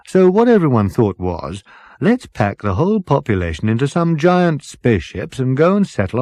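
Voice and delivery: breezy dotty captain waving off worry; jolly captain. Acted. Captain laughing off Ford's worry about the frozen bodies, jolly.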